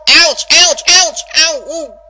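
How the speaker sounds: loud yelps of pain fading to a whimper; pained ouch cries. Acted. Rainbow hit by stabs of tooth pain, yelping. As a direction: yelp at each stab of pain, then trail off.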